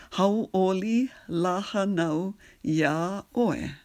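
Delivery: spoken slowly in clear chunks; slow practice phrase. Shown as a demonstration. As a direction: model it slowly for repetition. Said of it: "just practice that".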